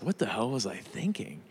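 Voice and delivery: hushed self-talk whisper. Retold or imitated. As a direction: whisper it to yourself in disbelief. Retold thought at old clothes.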